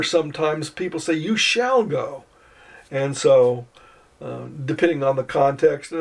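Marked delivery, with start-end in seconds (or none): 1.1-2.2 "You shall go" slow, "shall" stressed; stronger command. Shown as a demonstration. Teacher showing the strongest English command, stressing shall. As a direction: say it as a firm order. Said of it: "Or even stronger".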